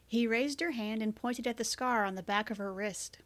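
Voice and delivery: ordinary delivery, nothing marked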